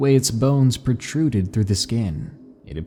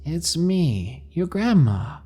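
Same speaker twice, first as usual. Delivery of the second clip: lightly voiced sweet old lady, higher; a hint of a coaxing grandmother; grandma voice; 7 semitones higher than usual. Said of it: "She responded in my grandma's voice".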